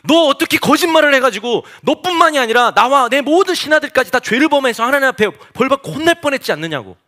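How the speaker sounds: high, loud royal scolding; angry king. Acted. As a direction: act out an outraged king's rebuke. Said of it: "블레셋의 왕이 막 화를 내잖아요".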